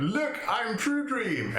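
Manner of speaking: high, slow dreamy proclamation; True Dream voice. Pretend. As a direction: announce yourself as someone else, drawn out. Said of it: "like jumping out".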